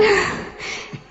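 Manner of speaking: a soft voiceless little laugh; quiet laugh. Real, not acted. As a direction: laugh quietly to yourself mid-story. Laughs while telling her dream. (धीमी सी हँसी)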